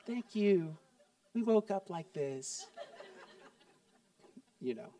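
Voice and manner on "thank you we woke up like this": soft, coy, slowing into a laugh; coy false modesty. Retold or imitated. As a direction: brush off the compliment with fake modesty. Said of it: "And you're like".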